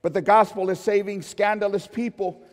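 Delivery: loud, rising preacher cadence with every contrast hammered; fiery pulpit delivery; full-force preaching. Acted. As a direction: preach it to the back row.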